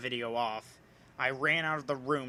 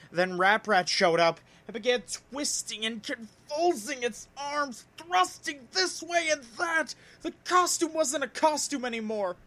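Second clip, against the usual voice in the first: higher, louder, building dread; rising horror narration; 9 semitones higher, 4 dB louder than usual. A narrator's reading. Narrator telling the transforming rat, mounting dread. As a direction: push it up as the terror peaks.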